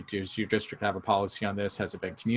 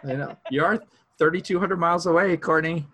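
louder and higher, playfully; teasing, raised voice; 8 semitones higher, 5 dB louder than usual. Pretend. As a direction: call it across a long distance. Teasing about the bad connection.

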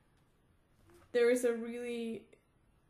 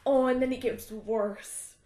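ordinary talk, nothing marked

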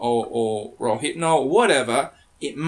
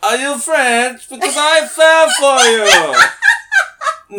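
Borat; 11 semitones higher, 11 dB louder than usual. Pretend. Host can't do French, falls back on loud Borat voice. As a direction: belt it out as a hammed-up caricature, cracking up. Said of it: "You do Borat really well".

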